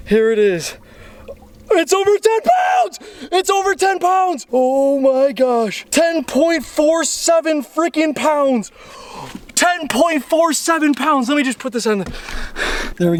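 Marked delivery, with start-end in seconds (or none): 1.7-4.5 real high-pitched shout of triumph; ecstatic. Real, not acted. Angler reads the scale over ten pounds, ecstatic. 4.5-11.3 slow, high, savoring every word; thrilled disbelief. Real, not acted. Angler announces the weight on the scale, thrilled.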